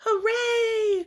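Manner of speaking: high loud happy shout; excited cheer. Acted. Little girl cheering on her first school day. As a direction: cheer like a kid running to school.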